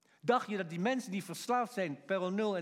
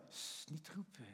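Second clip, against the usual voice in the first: much softer mock shushing; not-so-sweet shushing; 3 semitones lower, 15 dB quieter than usual. Bystanders telling the man not to call, soft shushing. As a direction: hush him softly, almost under the breath. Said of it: "echt niet lief, hoor, van".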